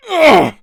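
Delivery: sudden high, loud choking grunt; pained grunt. Acted. Chapman is seized by his rebelling host, grunting. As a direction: cut off by a spasm.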